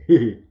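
short loud "he-he"; acted giggle. A narrator's reading. Kukuruza joking to be witty, giggling. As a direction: give a brief put-on chuckle. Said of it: "І хихикнув".

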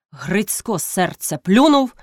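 usual speaking voice

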